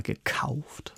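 ganz leise gehaucht, ohne Stimme; verschwörerisch flüstern; geflüstert. Real, not acted. Geflüstert, unstimmhaft. (leise geflüstertes gekauft)

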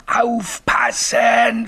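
very high, drawn-out scream; screamed warning. Acted. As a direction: stretch the word into a high ghostly shriek. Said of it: "schrie es". (langgezogener, gellender Warnschrei)

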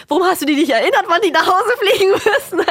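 mock yell at the husband, half laughing; retold yelling. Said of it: "erstmal angeschrien". (Mama schreit Papa gespielt an, höher und lauter)

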